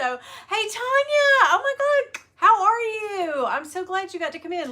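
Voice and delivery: high, loud squeal of welcome; big high-pitched hello, stretched out; excited greeting. Real, not acted. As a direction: greet a friend with a delighted shriek. Greeting a viewer joining live.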